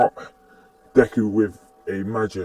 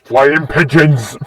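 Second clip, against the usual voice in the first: loud, drawn-out cry; exclaiming; 3 semitones higher, 6 dB louder, slower than usual. Acted. He spots a bunch of birds, loud drawn-out exclamation. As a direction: call it out slowly and loudly. Character calls out on seeing birds.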